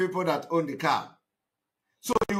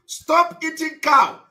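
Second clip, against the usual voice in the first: slow, loud and higher, like a sermon slogan; preaching slogan; 9 semitones higher, 6 dB louder, slower than usual. Retold or imitated. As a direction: drum the slogan out slowly and loud. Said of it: "we have been preaching".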